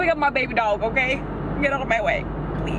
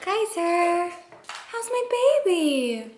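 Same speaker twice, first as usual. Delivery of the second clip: higher, softer pet coo; 7 semitones higher, 4 dB quieter, slower than usual. Real, not acted. Cooing to dog.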